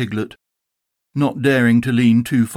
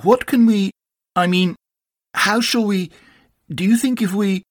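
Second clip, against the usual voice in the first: higher, halting and mildly flustered; distressed Pooh; 8 semitones higher than usual. A narrator's reading. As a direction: stumble anxiously through broken starts. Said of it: "said Pooh in distress".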